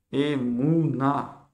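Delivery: Emouna stretched out very slowly, syllable by syllable; very slow spelled-out word. Shown as a demonstration. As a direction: draw out Emouna so students can copy. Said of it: "Emouna, ça veut dire la foi". (« Emouna » prononcé très lentement, syllabe par syllabe)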